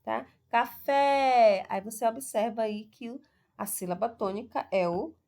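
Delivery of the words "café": "Café" called loudly with a long-held FÉ; loud stretched stress on the last syllable of "Café"; calling out. Shown as a demonstration. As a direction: call out "Café" as though summoning someone, stretching the end. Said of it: "como se estivesse chamando alguém".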